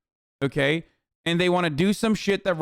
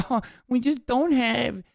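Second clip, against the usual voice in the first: higher, slow, whiny put-on voice; whiny mock Democrats; 8 semitones higher, slower than usual. Mocking Democrats who lost the filibuster, higher and whiny. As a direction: draw it out like a helpless Democrat. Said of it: "the Democrats are gonna be like".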